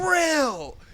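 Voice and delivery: high-pitched playful shout; sudden squealed exclamation; exclaimed shout. Pretend. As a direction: burst out with it, high and drawn out. Excited interjection, crying out genre name.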